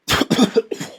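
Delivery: sharp choking cough; coughing on a swallowed bug. Real, not acted. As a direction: cough out a fly caught in the throat. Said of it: "I think I just swallowed a fly".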